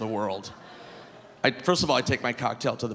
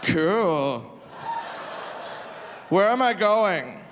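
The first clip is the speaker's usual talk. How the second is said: put-on drunk guy; 7 semitones higher, much slower than usual. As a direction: act the drunk taking it in stride. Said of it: "the guy goes".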